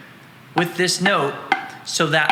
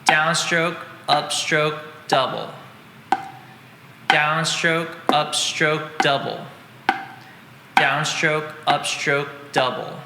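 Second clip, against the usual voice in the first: slow, even count of each stroke; steady counted chant of downstroke, upstroke, double; counting strokes slowly; slower than usual. Shown as a demonstration. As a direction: call the strokes out like a teacher keeping time. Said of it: "that's in super slow motion".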